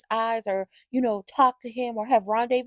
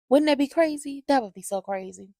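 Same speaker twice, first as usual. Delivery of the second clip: ordinary talk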